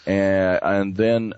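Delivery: ordinary talk, nothing marked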